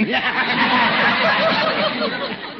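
high teasing laugh; hearty laugh. Acted. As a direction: laugh at a friend's expense. Judge laughs at his own joke.

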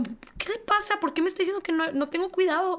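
high-pitched offended mother, retold; mock affronted, pitched well up; imitated indignation. Imitating an offended mother after her child bumped someone, indignant. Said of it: "y se fue enojada".

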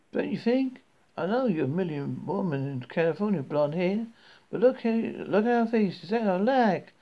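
high, chatty Southern woman; lightly voiced Southern talker; friendly Southern accent. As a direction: gush over a stranger in a Southern lilt. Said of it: "speaking in a friendly southern accent".

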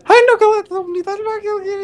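high, fast excited squeal; squealing girly voice. Acted. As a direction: gush like a starstruck fan. Girly squeal over someone.

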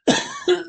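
one sharp loud cough. Acted. Striker coughing loudly to interrupt the meeting, a sharp cough. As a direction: cough hard to cut in.

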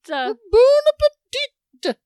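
high, loud warbling cook; hammed-up fluting TV cook; Julia Child. Host imitating the TV cook, high warbling voice. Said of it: "the Julia Child story".

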